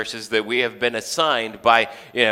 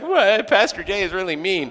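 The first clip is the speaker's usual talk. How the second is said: shocked listener; 9 semitones higher than usual. Retold or imitated. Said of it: "everybody else is like".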